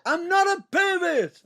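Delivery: loud, high, drawn-out protest; indignant shout. Real, not acted. Co-host denies being called a pervert, indignant shout. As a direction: shout it back, stretched and high-pitched.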